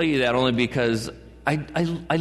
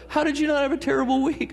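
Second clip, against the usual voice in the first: much higher, incredulous mock question; mock disbelief; 11 semitones higher than usual. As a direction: play someone astonished, pitched way up. Acts out exchange about terrible week.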